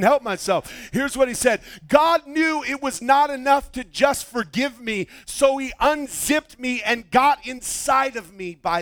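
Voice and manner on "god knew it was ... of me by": raised pitch, punching the key words; impassioned preaching. Real, not acted. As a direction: proclaim the quote with fervour. Delivers quote at preaching force.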